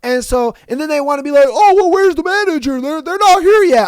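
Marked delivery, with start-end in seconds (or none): 1.5-3.9 high, louder whiny demand; whiny customer. Retold or imitated. Worker mimics a customer whining about where the manager is, high and loud.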